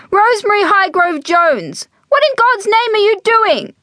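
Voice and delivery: high-pitched, outraged reprimand; scolding mother. A narrator's reading. Mother catches daughter photographing dogs, angry scolding. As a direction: tear into her, pitch soaring. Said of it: "Margaret hissed".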